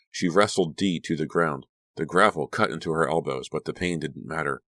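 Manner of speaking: usual speaking voice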